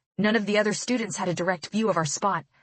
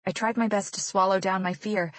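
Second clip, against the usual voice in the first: plain reading voice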